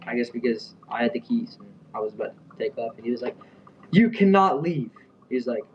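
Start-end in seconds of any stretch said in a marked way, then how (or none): 3.9-4.9 slow, raised mock yell; high, loud, drawn-out retold order; imitated yelling. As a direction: mimic an angry manager laying down the law. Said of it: "he starts yelling at me".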